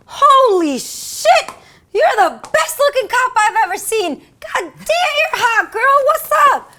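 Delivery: very high, loud, whooping shouts, imitated; astonished admiration. Suspect sees the cop in the light, whooping admiration. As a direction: holler it like a smitten catcaller. Suspect suddenly smitten with officer.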